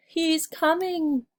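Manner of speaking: slow, high, urgent warning; a giantess gasping out a warning; alarmed cry. Acted. The giantess warns Jack the giant is coming, high and alarmed. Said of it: "cried the giantess".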